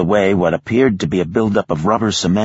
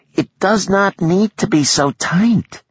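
high, whiny protest; whining. A narrator's reading. Girl protests the tight cuffs with a high whine. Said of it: "a whine in her voice".

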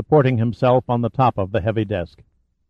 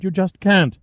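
insistent; 8 semitones higher than usual. Acted. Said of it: "Summer persisted".

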